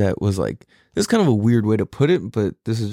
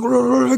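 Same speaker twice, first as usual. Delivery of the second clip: mock wrestler roar; 11 semitones higher, 3 dB louder than usual. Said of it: "he's just like".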